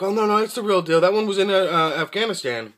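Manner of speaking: lightly voiced salesman bluffing; mock clerk insisting. Clerk insists to the customer, lightly mocked insisting.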